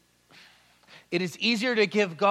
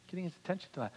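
much softer repeat, "attention" falling gently; soft echo; 15 dB quieter, much faster than usual. Shown as a demonstration. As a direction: echo it back quietly. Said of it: "We're getting his attention tonight".

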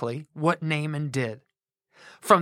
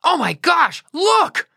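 high, loud astonished yell; shrieking oh my gosh, very high; 11 semitones higher, 5 dB louder, slower than usual. Acted. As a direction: point and shout in amazement. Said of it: "someone pointed at the wet general and yelled".

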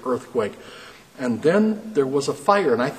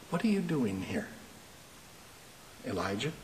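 much softer, hushed and tender; gentle whisper; 3 semitones lower, 12 dB quieter than usual. A narrator's reading. God speaking to Elijah at the cave, gentle whisper. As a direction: barely breathe it to him. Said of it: "in a gentle whisper, not harsh".

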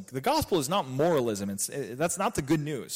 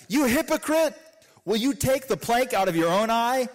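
rebuking hypocrite; 6 semitones higher than usual. Acted. Jesus rebuking in quoted scripture.

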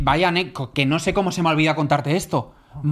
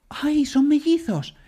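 put-on high, slow cooing old lady; imitating an elderly lady delighted by twins; 8 semitones higher, much slower than usual. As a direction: stretch it out like a sweet old woman. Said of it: "Y me dice".

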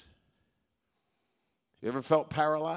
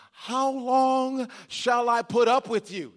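slow, weary exasperation, a bit higher; exasperated Jesus; 5 semitones higher, slower than usual. Retold or imitated. Preacher voicing Jesus fed up with the crowd, slow exasperation. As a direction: let the sigh of patience wearing thin come through. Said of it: "You didn't think Jesus talked like that".

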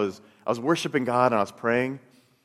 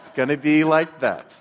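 louder, laughing defiance; defiant challenge; 3 semitones higher, 7 dB louder than usual. Retold or imitated. Frustrated single man daring a silent God, louder with a laugh. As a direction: throw it back at God with a bitter laugh. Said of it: "And I was angry".

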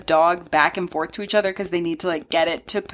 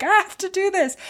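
determined twin; 13 semitones higher than usual. Retold or imitated. Ashley voiced in retell.

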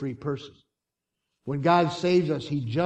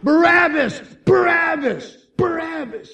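high, loud crowd chant; angry crowd shout; 10 dB louder than usual. Acted. Angry crowd shouting a name toward the prison, loud. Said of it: "his name shouted by an angry".

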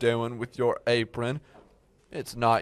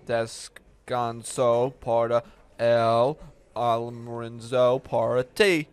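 slow, laboured beginner's Spanish; painstakingly drawn-out foreign phrase; halting Spanish; slower than usual. Acted. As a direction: pick through the Spanish word by word. Boss attempts halting Spanish for cook.

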